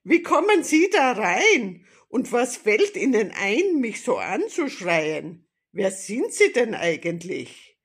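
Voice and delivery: loud, high indignant grandmother; indignant cry. A narrator's reading. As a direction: call it out in outrage. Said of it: "rief sie entrüstet".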